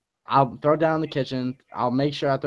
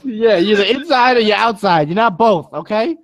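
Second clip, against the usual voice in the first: high, loud mock dad scolding; hammed-up father telling off kids; yelling dad; 7 semitones higher, 7 dB louder than usual. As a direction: lay down the law like an exasperated parent. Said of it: "that's why he's yelling at you".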